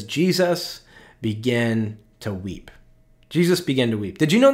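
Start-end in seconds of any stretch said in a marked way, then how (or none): 0.0-2.7 reading scripture slowly and solemnly; slow reverent reading. Shown as a demonstration. Pastor reads the shortest verse to the congregation, slow and reverent. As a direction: dwell on each word of the verse. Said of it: "It says".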